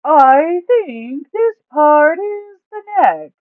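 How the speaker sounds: high, loud, slow voice of big Duke; booming Duke. A narrator's reading. Duke laying out the plan to the party, loud and slow. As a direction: call it out big and slow, stretching every word.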